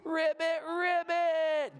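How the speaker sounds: high, loud croak call; loud ribbit. Shown as a demonstration. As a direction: shout the ribbit out. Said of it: "Can you say that one more time?".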